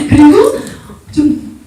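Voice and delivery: ordinary talk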